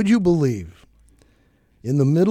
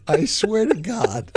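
laughing; 7 semitones higher, slower than usual. Real, not acted. Laughing while telling.

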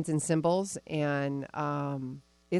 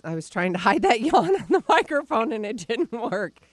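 laughing; 4 semitones higher, 6 dB louder than usual. Real, not acted. Laughs while confessing the yawn.

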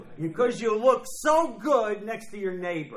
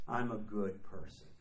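mocking smugness; 9 semitones lower, 8 dB quieter than usual. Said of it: "There's the key word".

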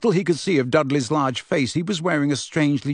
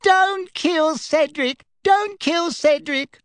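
high, slow whimpering mockery; cringing submission; 13 semitones higher, 3 dB louder, slower than usual. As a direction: whimper it out, mocking fear. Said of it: "adopted a high-pitched, whimpering voice".

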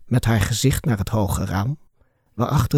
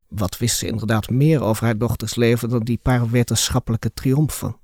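ordinary delivery

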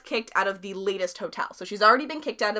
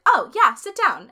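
high, louder airy brush-off; breezy dismissive; 9 semitones higher, 10 dB louder than usual. Retold or imitated. Friend voicing Anna's airy sit down. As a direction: wave her off, all casual.

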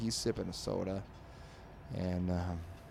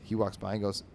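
ordinary delivery, nothing marked

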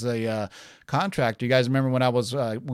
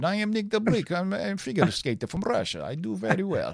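higher, hammed-up Russian accent; mock Russian accent; 7 semitones higher than usual. Host jokes on the podcast, in a hammed-up Russian accent. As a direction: play a Russian skater in a jokey Russian accent. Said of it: "but I tried to do it".

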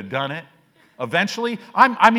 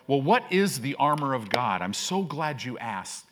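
ordinary talk, nothing marked

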